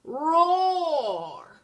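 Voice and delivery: big, high, loud dragon roar. A narrator's reading. Dragon roars loudly.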